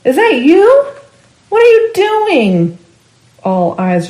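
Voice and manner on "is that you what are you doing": high, loud alarmed shout. A narrator's reading. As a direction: call up in shock, much higher.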